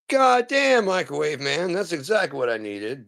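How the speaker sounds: high, gushing mock woman; hammed-up excited customer. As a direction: squeal it like a thrilled lady. Acted character quote.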